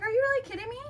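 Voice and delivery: high, loud disbelief; incredulous retort. Retold or imitated. Daughter to dad asking for money, incredulous. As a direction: fire back in high-pitched exasperation.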